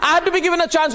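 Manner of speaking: high, insistent cry for silence; angry demand. Real, not acted. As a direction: shout the guest down. Shouting down an interrupting guest.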